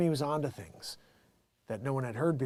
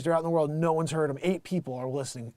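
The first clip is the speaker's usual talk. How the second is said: ordinary talk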